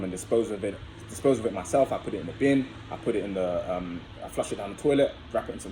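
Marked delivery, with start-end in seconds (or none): none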